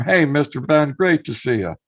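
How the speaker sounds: ordinary talk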